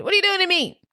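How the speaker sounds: high, strained put-on voice; mock dramatic read. Reading the card found in Liz's stuff, high and strained. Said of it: "and it said".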